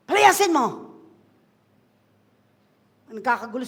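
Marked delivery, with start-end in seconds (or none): 0.1-0.7 loud, higher command to cast out; loud preacher command. Acted. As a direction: bark it at the crowd.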